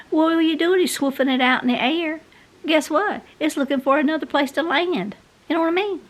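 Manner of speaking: high-pitched disbelieving rant; exasperated. Real, not acted. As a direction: scoff at how pointless it is. Scoffing at feather dusters.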